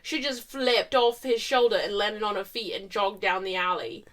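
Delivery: usual speaking voice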